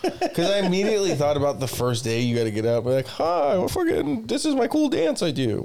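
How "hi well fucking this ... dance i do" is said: high-pitched, hammed-up show introduction; mock contestant intro. Imagined dating-show contestant introducing his cool dance, mock-peppy and high. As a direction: put on a silly, high contestant voice.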